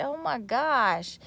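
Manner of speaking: normal talking voice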